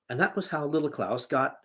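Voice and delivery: ordinary delivery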